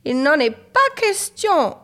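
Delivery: high, loud, drawn-out mother's veto; exclaimed refusal. A narrator's reading. Mother refuses Marine a boat, emphatic exclamation. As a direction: exclaim it slowly and forcefully. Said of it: "s'exclama sa maman".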